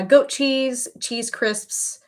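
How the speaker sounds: usual speaking voice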